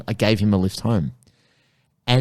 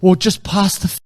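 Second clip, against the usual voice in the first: exasperated; 6 semitones higher, 5 dB louder than usual. Retold or imitated. Scolding the blue belt.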